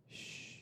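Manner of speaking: soft unvoiced shh; shushing. Worshipper hushing a neighbour during worship, unvoiced shh. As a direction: quietly hush the person beside you. People next to you shushing.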